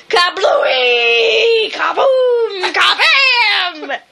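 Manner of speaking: mock artillery bursts; shell blasts. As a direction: bark out booms like artillery. Imitating artillery explosions.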